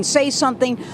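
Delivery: ordinary delivery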